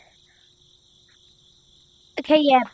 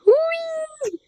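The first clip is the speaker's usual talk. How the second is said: very high airplane whee; high squeal; 11 semitones higher, 4 dB quieter than usual. Pretend.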